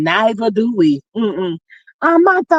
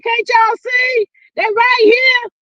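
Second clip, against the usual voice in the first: high, proud shout; 13 semitones higher, slower than usual. Retold or imitated. To the teasing aunt, a high proud shout. Showing off her hips to aunt.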